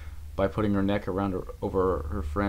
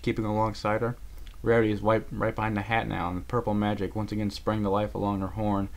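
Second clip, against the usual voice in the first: plain reading voice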